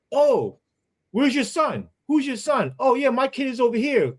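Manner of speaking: a hint of a chatty parent; normal friendly parent. Other parents chat about kids, lightly voiced cheerful tone. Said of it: "they're like".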